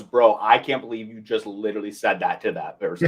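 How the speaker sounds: imitated deadpan bro disbelief; mock bro email. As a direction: drop it low and quiet like a stunned bro. Reads out colleague's bro-style email.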